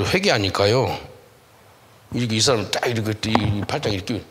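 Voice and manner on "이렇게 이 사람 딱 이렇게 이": ordinary delivery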